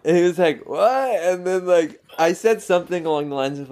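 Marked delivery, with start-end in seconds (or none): 0.7-1.1 confused what. Retold or imitated. Confused stranger's reply.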